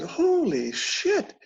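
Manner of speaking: very slow, high-pitched exclamation; acted amazement, voice soaring; retold astonishment. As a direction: draw it way out in mock shock. Retells seeing blood spray from his head.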